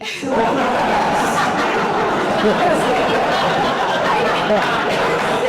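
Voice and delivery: long, loud breathy burst of laughter; loud breathless laugh. Real, not acted. Actress recalls the misspelled banner, bursting into loud laughter. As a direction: crack up at the misspelling.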